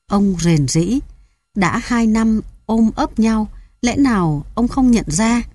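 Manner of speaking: plain reading voice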